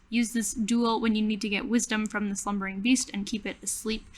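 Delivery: normal talking voice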